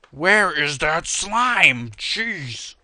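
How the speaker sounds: loud, high, drawn-out complaint; exasperated. Real, not acted. Player fed up hunting for a slime, exasperated. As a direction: let the frustration burst out slowly.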